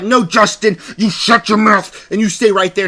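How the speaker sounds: exaggerated stern order; mock scolding. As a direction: imitate her shutting down her co-host. Acted scolding of the co-host.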